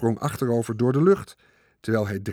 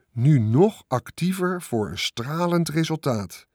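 ad slogan read; 6 semitones higher than usual. Acted. Reading ad slogan aloud.

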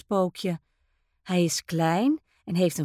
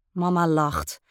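ordinary delivery

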